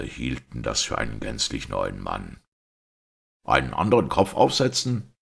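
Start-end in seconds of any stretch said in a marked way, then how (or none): 3.4-5.1 high, loud incredulous question; stunned, voice jumping up; taken aback. A narrator's reading. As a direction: echo it back in startled disbelief. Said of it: "meinte O'Brien betroffen".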